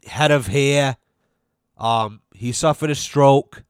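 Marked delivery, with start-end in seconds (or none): none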